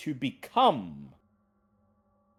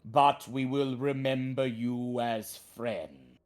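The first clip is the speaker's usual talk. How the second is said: talking tree; 3 semitones higher, 4 dB louder than usual. Acted. Treant gives gift.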